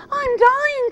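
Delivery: put-on very high, loud squeaky baby bear wail; hammed-up tiny bear crying out. A narrator's reading. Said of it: "cried Baby Bear".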